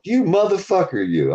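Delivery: fake-angry at a prank; mock outrage. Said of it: "I'm like".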